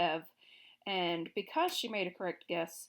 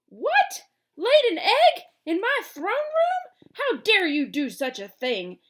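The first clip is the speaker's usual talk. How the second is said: much higher, shrieking furious king; 11 semitones higher, 13 dB louder, slower than usual. A narrator's reading. Gnome King furious about an egg in his throne room. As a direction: explode in outrage. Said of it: "in a voice of fury".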